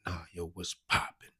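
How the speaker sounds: slow, emphatic challenge; pointed challenge. Retold or imitated. Gang member testing a stranger he approached, pointed challenge. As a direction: press the greeting slowly, with weight.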